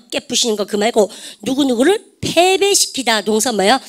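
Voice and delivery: normal talking voice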